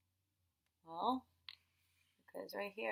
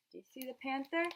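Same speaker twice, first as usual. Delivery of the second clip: ordinary talk, nothing marked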